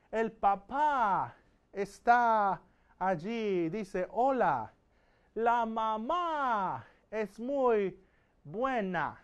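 very slow, each word laid out for learners; slow model sentences. Shown as a demonstration. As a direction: spell it out slowly for the class. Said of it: "teacher slow model sentences".

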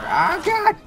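very high loud startled yell; startled shriek. Real, not acted. Player startled while reading a place name, high shriek. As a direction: jump at the sudden attack. Sudden attack.

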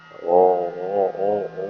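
slow, drawn-out sobs; sobbing. Acted. Finally with her mother again, slow sobbing. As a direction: let the crying spill out.

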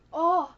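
loud, drawn-out fan groan; swooning loud exclamation; excited groan. Real, not acted. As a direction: let out a big overwhelmed "oh". Overcome with excitement about Shenmue.

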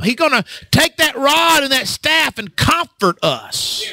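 rising preaching. Acted. Preaching at full force.

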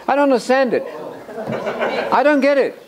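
high-pitched protest of incomprehension; mock bafflement. Preacher playing someone lost by the theology quote, mock bafflement.

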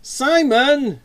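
loud, high sisterly shout; angry yell. A narrator's reading. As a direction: yell at a pest of a brother. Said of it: "she yelled before turning to slap".

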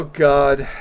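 normal talking voice

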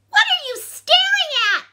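very high, loud squeak of indignation; squeaky ladybug. A narrator's reading. Ladybug to staring bear at breakfast, squeaky. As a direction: squeak it like a tiny annoyed bug. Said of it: "squeaked the ladybug".

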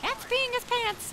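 put-on a slow, high small-boy punchline; kid's punchline. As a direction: sing it up high like a giggling boy. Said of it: "And they'll say".

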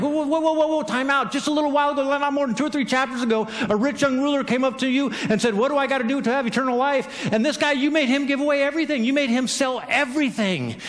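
high, urgent interruption; indignant objection. Acted. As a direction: call time out and argue the unfairness. Acted protest to Jesus.

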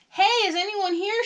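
high, loud searching call; yelling. A narrator's reading. Molly calls to unseen children, yelling. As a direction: call out to find someone. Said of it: "she yelled".

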